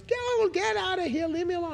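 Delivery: imitating angry homeless man. Retelling a homeless man ranting at him, high mock rant.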